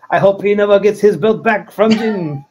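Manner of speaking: mock-indignant bad French accent; pissed French fan. All of France reacting to the host, annoyed French accent. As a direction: put on a miffed French accent. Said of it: "all of France is pissed".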